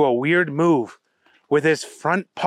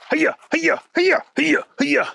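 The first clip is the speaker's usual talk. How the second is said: short, high, rapid-fire yelps; one sharp cry per blocked punch; karate blocking cries; 13 semitones higher than usual. Acted.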